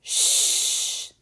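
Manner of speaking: voiceless hushing "Sh"; sh sound. Shown as a demonstration. Teacher to kids, sounding out shine. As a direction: hush out "Sh". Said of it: "break that down into the sounds".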